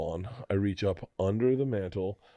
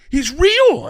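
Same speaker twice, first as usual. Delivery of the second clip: put-on high, loud amazed child; hammed-up child's awe, much higher and louder; excited kid; 10 dB louder than usual. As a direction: shriek it like a thrilled kid. Kids believe Santa caught.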